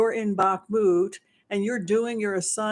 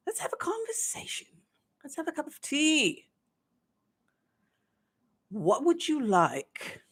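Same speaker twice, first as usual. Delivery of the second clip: mock British official; 4 semitones higher than usual. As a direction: coax him in with a syrupy tone of voice. British officials courting Prigozhin.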